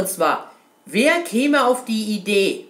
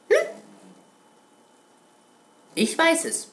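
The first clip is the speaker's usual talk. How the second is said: ordinary talk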